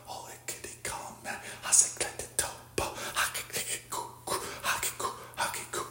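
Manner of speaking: hushed, voiceless whispered chant; whispering. Acted. Cursing the demonic world in a harsh whisper. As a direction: whisper it as a secret curse.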